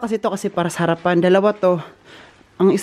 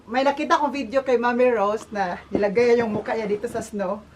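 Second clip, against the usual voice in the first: ordinary talk, nothing marked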